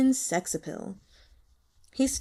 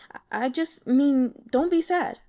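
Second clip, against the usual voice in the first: halting, then pitched higher and a bit louder; awkward consoling; 5 semitones higher, 4 dB louder than usual. Acted. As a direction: stammer it out, then plead. Guo fumbling to console her.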